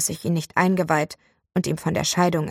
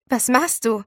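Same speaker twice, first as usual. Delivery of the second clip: baffled; 5 semitones higher than usual. Acted. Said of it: "fragte ich verdutzt".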